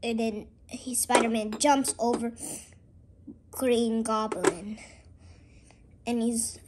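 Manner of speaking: ordinary talk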